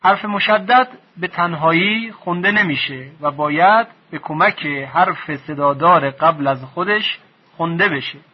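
ordinary talk, nothing marked

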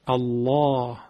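الله with a thick, heavy L and dark open 'aa'; heavy lam. Shown as a demonstration. Quran teacher showing the lam after fatha, heavy lam. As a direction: say الله full-mouthed, the L thickened from the throat.

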